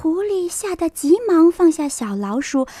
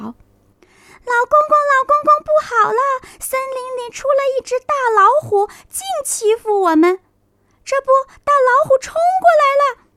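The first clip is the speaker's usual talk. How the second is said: high, fast urgent pleading; tiny creatures begging for rescue; little animals; 12 semitones higher, 3 dB louder than usual. Acted. Animals complain to grandpa about the tiger, high and urgent.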